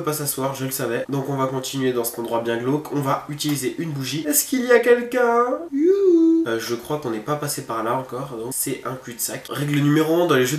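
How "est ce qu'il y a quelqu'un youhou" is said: high, loud call into the dark. Real, not acted. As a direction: sing out to find someone. Calling out into dark room.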